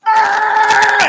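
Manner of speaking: a sharp, very high, loud yell at the TV; sudden yell. Retold or imitated. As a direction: blurt out a loud cry of frustration. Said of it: "and I'm like".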